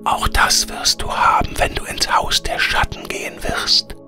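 air spirit whispering. A narrator's reading. Air spirit bids Veronika farewell, in a whisper. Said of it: "sagte der Luftgeist und lächelte freundlich".

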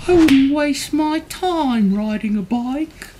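high, sniffy cat voice; disdainful cat. A narrator's reading. Cat thinking about Duck's bike, high disdainful voice. Cat's haughty thought, acted.

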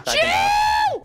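very high, long scream of the name; shouted name. Real, not acted. Player crying out to Jill, high shout. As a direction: shriek it out across the map.

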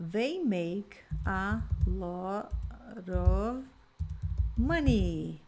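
very slow, careful modelling; slow model sentence. Shown as a demonstration. Teacher modelling a sentence for students, very slow. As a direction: drag each word out for the learners. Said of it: "Então, ó".

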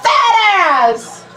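high, slow exasperated yell at the character; gamer rage. Real, not acted. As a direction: shout the insult in real frustration. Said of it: "Gamer rage".

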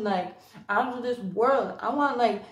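ordinary talk, nothing marked

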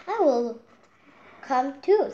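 high, loud eager offer; volunteer with a cluck of zeal; eager hen. Acted. Henny Penny offering to come along, eager and high. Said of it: "said Cookie Lookie".

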